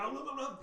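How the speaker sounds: high-pitched stumbling babble; mock flailing babble. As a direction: babble comically as if losing your footing. Voices player flailing after stumbling.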